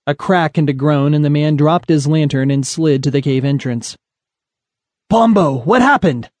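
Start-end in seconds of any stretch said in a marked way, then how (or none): 5.1-6.3 higher-pitched urgent call; alarmed call. Acted. Tough calls to his companion after a crash in the cave, alarmed call. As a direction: call out in sudden alarm, voice rising.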